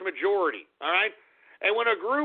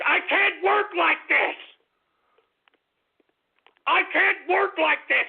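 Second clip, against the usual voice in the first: frustrated outburst; 6 semitones higher than usual. Acted. Tantrum at technical difficulties.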